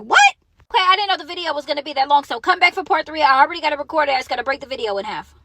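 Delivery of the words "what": loud, very high yelp of disbelief; shocked "what". Retold or imitated. Kids' dad hearing of robbery by phone, shocked, retold. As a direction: shoot the voice up, imitating shock.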